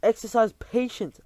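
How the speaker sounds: high, loud reproof; calm them sternly; commanding. Acted. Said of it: "answered the Grand High Witch".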